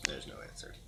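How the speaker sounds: whispered echo. Real, not acted. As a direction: breathe it out, no voice. Repeats GM line whispered.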